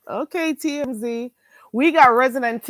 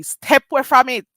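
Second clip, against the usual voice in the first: loud, punchy Jamaican Patois; 2 semitones higher, 7 dB louder than usual. Shown as a demonstration. Streamer warning off accent critics, emphatic Jamaican Patois. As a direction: order them back in emphatic Jamaican Patois. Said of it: "should I say it in a, another way".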